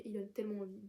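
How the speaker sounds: ordinary talk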